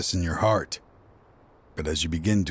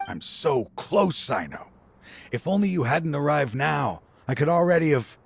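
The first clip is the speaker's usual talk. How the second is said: feverish longing; 7 semitones higher than usual. Acted. Scholar tells Cyno how close he came, pitch straining high. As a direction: pitch climbing, voice straining higher. Villain near his goal.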